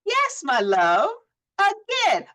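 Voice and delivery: slow, sing-song, high final again; playful firm reply. Retold or imitated. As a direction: tease her gently into doing it again. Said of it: "coach answers client fondly".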